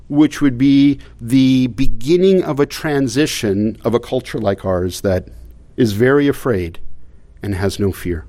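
ordinary delivery

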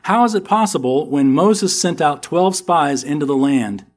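normal talking voice

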